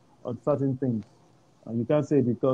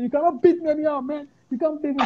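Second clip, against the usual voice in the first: mock defiant yell; 13 semitones higher than usual. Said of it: "I said".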